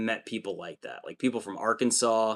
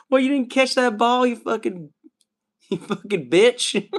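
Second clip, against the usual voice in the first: much higher, louder mock mom yelling at the TV; mom yelling at football; 12 semitones higher, 5 dB louder than usual. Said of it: "I could see your mom being like".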